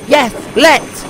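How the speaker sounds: high eager yes; excited. A narrator's reading. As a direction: jump at the chance. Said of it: "said Percy excitedly".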